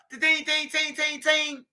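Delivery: high, quick tings of bullets on metal; ping them out like shots on a pole; ricochet sounds. Retold or imitated. Imitating bullets again.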